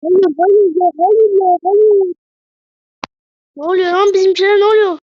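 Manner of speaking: high, loud cries of no; desperate shouting. Real, not acted. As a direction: yell in disbelief at the screen. Team concedes during live match.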